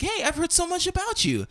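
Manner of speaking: very high, chummy imitation; mock friendly greeting. Ex introducing himself to him, mock friendly greeting. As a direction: pitch it way up like an over-nice stranger.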